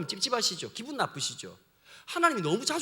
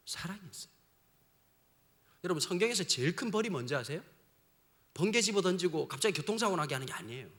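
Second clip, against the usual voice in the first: ordinary talk, nothing marked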